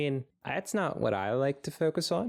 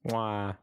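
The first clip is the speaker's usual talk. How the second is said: blowing kisses. Pretend. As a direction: blow a kiss to the audience. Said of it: "getting Ross kisses".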